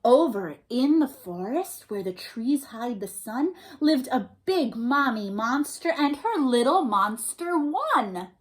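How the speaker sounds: normal talking voice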